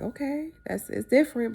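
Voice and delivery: hesitant father. Retold or imitated. Father reacting to the poly lifestyle news, hesitant.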